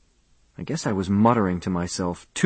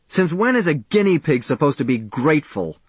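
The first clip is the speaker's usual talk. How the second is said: raised, bitter shout; 6 semitones higher than usual. A narrator's reading. Charlie shouting at Niemer at the party, bitter outburst. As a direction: hurl the question back at him. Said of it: "I shouted".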